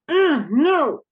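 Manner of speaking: high, loud annoyed "Ugh, no"; angry demo of not wanting something; demonstrating anger. Shown as a demonstration. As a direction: refuse what you're getting, exasperated. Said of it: "I'm angry in a basic way".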